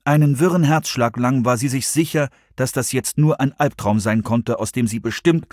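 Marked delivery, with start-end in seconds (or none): none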